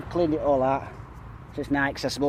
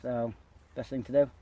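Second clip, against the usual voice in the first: normal talking voice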